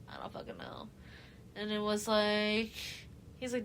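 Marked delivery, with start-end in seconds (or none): 0.1-0.9 very low, barely voiced aside; mock feigned ignorance. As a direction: drop to a near-whisper, imitating her denial. Retold feigned ignorance when confronted.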